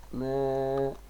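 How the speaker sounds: "ماء" stretched as "maaa... un"; long held ماء, then -un; tanween damma ending. Shown as a demonstration. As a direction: sound out ماء with the nunation voiced. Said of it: "تنوين بال".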